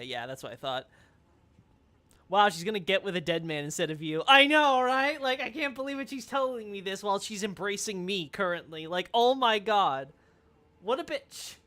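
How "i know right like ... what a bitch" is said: mock outrage. Outraged at Fia's confession.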